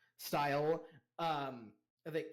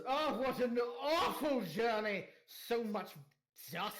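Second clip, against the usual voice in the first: complaining noble lady; 4 semitones higher than usual. Acted. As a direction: whine about the journey like a pampered noble. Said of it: "and goes".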